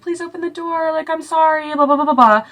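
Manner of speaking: high, mock pleading voice; sing-song begging that dissolves into blahs; pleading apology. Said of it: "she's, like, calling out for Liam, apologizing".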